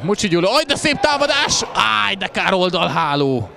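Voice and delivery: high, loud dismayed cry; groan-shout at the near miss; excited sports commentary. Real, not acted. (izgatott sportkommentár)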